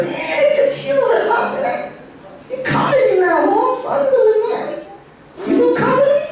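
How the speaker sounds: high, Spanglish homeboy uncle voice. Retold or imitated. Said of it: "he totally talks like that".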